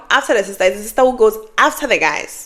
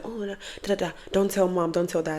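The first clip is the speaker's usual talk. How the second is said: much softer, hushed request; secretive plea; 9 dB quieter than usual. Pretend. Asking a sibling not to tell mom, hushed. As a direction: keep it between siblings, hushed.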